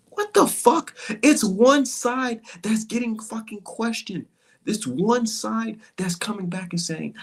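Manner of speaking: exploding at fans who won't question Inoue; outraged yell. Real, not acted. Angry rant at boxing fans.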